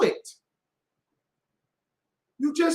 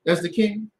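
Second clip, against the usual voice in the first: ordinary delivery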